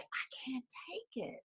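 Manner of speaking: a defeated, breathless whisper. Retold or imitated. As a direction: barely whisper it, completely drained. Exhausted owner at her limit.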